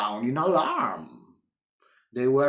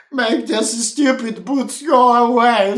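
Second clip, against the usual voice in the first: loud angry demand; shouted; 5 semitones higher, 7 dB louder than usual. A narrator's reading. Miss Bossy yelling at Wilfred, loud demand. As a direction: yell at the boots to vanish. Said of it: "shouted little Miss Bossy".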